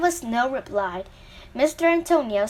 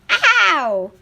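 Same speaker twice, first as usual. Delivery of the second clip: high, loud cry of pain; wailed ow; 5 semitones higher, 8 dB louder than usual. A narrator's reading. Wee voice in the wood as the axe struck, wailing. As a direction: yelp it out, high and falling. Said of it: "wailed the same wee voice".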